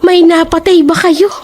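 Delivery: high, loud, demanding; landlady calls out the killing question loudly; loud accusing question. Acted. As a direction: blurt it out for all to hear. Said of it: "hinaan yung boses nyo".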